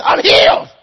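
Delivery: explosive, very loud yell; exultant shout. Retold or imitated. Healed man answering the preacher, acted exultant shout. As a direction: burst out with the news at full volume. Retelling a healed man's cry.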